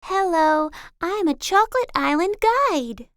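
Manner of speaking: a hint of a welcoming tour guide; friendly guide. A narrator's reading. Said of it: "the guide showed them around".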